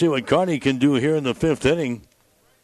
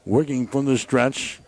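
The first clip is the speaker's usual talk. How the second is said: excited baseball radio call; live play-by-play; 3 semitones higher than usual. Real, not acted. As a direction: call the action as it happens.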